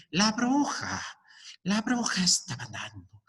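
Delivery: a hint of a cackling old witch. Shown as a demonstration. Teacher shows students a witch voice while storytelling. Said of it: "modulaban su voz".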